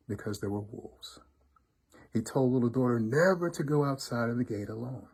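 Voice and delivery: ordinary delivery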